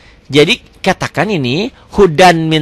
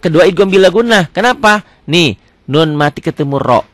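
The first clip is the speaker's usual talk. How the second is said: ordinary delivery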